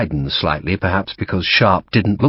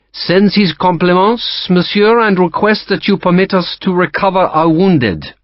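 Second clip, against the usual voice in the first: polite French officer, higher and louder; courteous French-accented captain; 10 semitones higher, 5 dB louder than usual. Acted. French captain to Sharp, polite French accent. Lacroix voiced by narrator.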